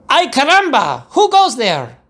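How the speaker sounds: high, loud hero challenge; startled challenge. A narrator's reading. Kitten hero challenging the band, high and loud. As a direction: call out bravely into the dust. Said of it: "asked Skippy John Jones".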